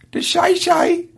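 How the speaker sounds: tentative high falsetto question. Shown as a demonstration. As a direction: try the catchphrase in falsetto without yelling. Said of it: "can't do this falsetto thing".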